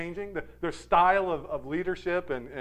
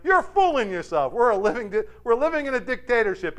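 raised, louder mock protest; yelling back; 7 semitones higher, 4 dB louder than usual. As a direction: imitate a peasant heckling the king. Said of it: "Man yells back".

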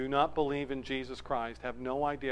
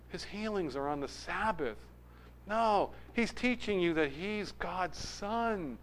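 skeptical crowd member; 6 semitones higher, 5 dB quieter than usual. Acted. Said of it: "others are going".

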